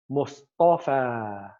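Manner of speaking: drawn-out, very slow "Mustafa"; slow demo word. Shown as a demonstration. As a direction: stretch out "Mustafa" for the class. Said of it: "Mustafa is a word that's mabni".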